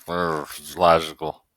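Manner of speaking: low, groaning Spock send-up; low mock-Vulcan grumble; mock Nimoy.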